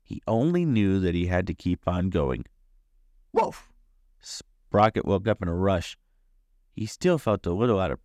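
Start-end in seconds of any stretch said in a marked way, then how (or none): 3.3-3.7 short high-pitched woof; sudden yelped woof; dog bark. A narrator's reading. Barking sound of Sprocket the dog.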